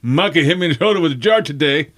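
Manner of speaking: fast, high tattletale voice; put-on high, rushed tattling; tattling brother. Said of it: "Bob goes".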